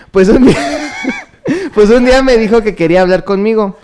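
giggling through the words; laughing while reading. Real, not acted.

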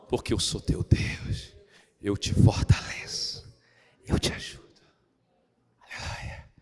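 slow, hushed whisper of the promise; whispered promise of God; whispered scripture. Real, not acted. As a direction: murmur the verse tenderly. Whispered verse recital.

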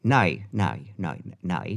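"no" in a broad mock Australian accent; exaggerated Australian vowel on "no". Shown as a demonstration. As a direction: try out an Australian "no", each one separate. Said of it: "that's Australian for no".

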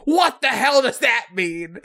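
high, hammed-up yell; mock outraged yell. Retelling a video of two people fighting, mock outraged yell. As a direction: imitate a baffled man yelling back.

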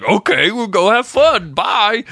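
high, cheery mock-oblivious goodbye; blithely unsuspicious. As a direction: imitate a clueless dad happily leaving. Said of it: "Sandy's not. He's just like".

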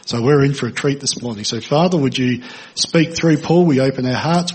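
normal talking voice

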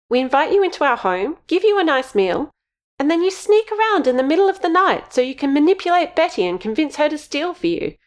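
high, rising angry outburst. Acted. Missus J angry tirade.

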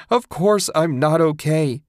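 high, loud shrill screech. A narrator's reading. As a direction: screech it in fury. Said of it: "she screeched".

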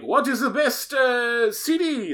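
put-on a horrible Japanese accent, pushed up high; mock Japanese accent. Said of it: "That was a horrible Jap".

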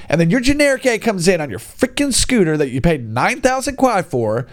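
usual speaking voice

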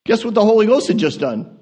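ordinary talk, nothing marked

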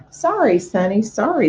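goose voice repeating words, busy; a hen goose brushing off the pig; fussy mother goose. Acted.

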